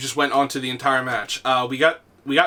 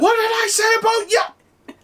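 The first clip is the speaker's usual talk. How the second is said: very high, strained mock telling-off; imitated scolding parent; 3 dB louder than usual. Parent interrupts screaming gamer kid, imitated scolding. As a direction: scold like a parent overheard on a mic.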